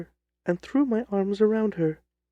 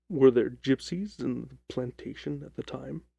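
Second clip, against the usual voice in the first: lower, measured detective voice; a calm, lower Holmes cutting in; 5 semitones lower than usual. Acted. Holmes questions the client.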